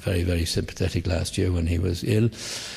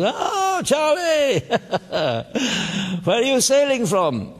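high mock-posh yacht club gent; fellow from the yacht club; 13 semitones higher, slower than usual. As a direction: ham up a plummy sailor hailing Charlie. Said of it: "who says".

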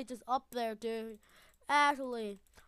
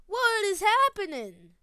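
normal talking voice

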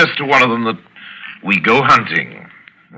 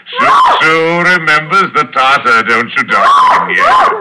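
exultant call; 8 semitones higher, 5 dB louder than usual. Acted. Villain calling to someone off.

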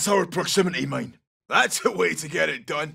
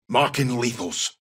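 usual speaking voice

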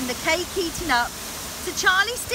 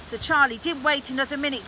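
ordinary delivery

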